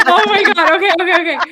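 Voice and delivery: high, loud burst of disbelief; shrieking with delight; real excited shriek. Real, not acted. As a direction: scream it at the lucky roll. After huge damage roll.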